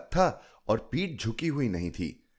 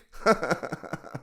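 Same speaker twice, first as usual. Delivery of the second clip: a breathy burst of laughter; hearty laugh; 8 semitones higher than usual. A narrator's reading. Storyteller laughing at Chilki's question, breathy laugh. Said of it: "मैं ठहाका मारकर हंस दी".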